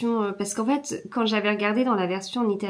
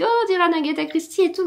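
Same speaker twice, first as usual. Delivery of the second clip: imitating subscribers; 8 semitones higher, much faster than usual. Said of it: "les abonnés Patreon me l'avaient dit aussi".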